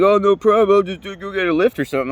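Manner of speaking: high, loud brush-off in another man's voice; mock-breezy passenger. Passenger shrugging off the long walk, mock-breezy. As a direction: imitate him airily waving away the problem.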